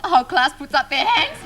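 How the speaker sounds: ordinary delivery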